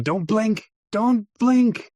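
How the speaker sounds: high-pitched mock yell at an actor; mock director's scream. Director screaming at the unblinking actor, high mock yell. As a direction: impersonate a director yelling orders, pitch jumping up. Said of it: "would just scream at him".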